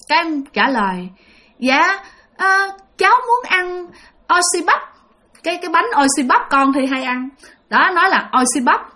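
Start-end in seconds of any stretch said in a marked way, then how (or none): 1.6-7.3 put-on higher, shy small-child request; lightly voiced kid asking for a snack; child's voice. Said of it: "cái giọng của một đứa con nít". (giọng con nít rụt rè)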